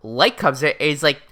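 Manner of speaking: ordinary delivery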